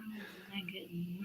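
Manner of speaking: breathless awed whisper. Real, not acted. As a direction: gasp it out, overwhelmed. Overwhelmed by backer count.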